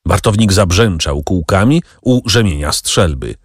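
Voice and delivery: plain reading voice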